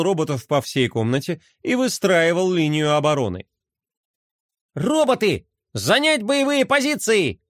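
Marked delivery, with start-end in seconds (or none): 4.7-7.4 a hint of a barking general, higher; commanding general. A narrator's reading. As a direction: give orders like a toy general. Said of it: "скомандовал Рома за генерала".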